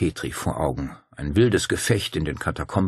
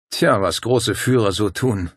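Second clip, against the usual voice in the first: slow, scornful remark; Paul scorns Martin's great leaders, slow and contemptuous; 3 semitones higher, 4 dB louder than usual. Acted. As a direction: let the contempt drip, slowly. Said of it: "sagte Paul verächtlich".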